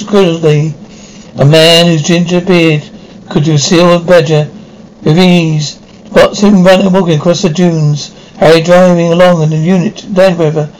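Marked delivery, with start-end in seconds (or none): none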